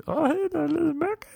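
put-on very high squeaky baby talk; cute pet-talk voice. Girl to her boyfriend in her dog voice, squeaky cutesy. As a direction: coo in falsetto as if to a pet. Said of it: "tried to say to me".

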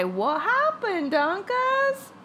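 slow question climbing very high; hammed-up high-pitched questioning; mock concern. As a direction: stretch it out and squeak up on the name. Mock question to film character.

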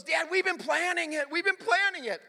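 high-pitched flustered son protesting; imitating an upset fifth grader, high voice; flustered kid. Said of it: "he's all flustered".

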